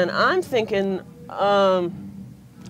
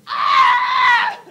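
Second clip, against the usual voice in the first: high loud mock scream of ear pain; passenger scream; 9 dB louder than usual. Passengers with ears bursting at landing, loud high scream. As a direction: shriek like your ears are bursting. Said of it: "like, screaming".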